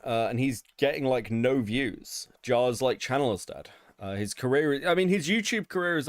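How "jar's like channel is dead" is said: usual speaking voice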